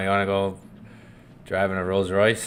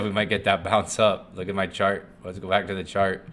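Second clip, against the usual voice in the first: ordinary talk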